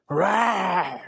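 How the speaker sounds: sudden loud, high roar; big drawn-out imitated roar; lion roar. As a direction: roar at a little mouse like a lion. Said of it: "it roared all day".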